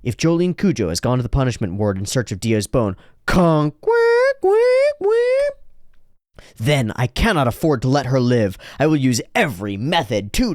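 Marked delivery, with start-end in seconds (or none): 3.8-5.6 high, loud quacking; mock duck quacks. Imitated sound in monologue.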